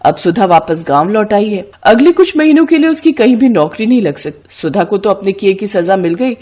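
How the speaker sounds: ordinary delivery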